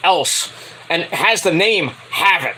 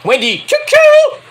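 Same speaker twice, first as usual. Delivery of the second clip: loud, high drawn-out call; excited callout; 6 dB louder, slower than usual. Real, not acted. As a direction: announce her like a crowd favorite. Hyping a favorite wrestler's name.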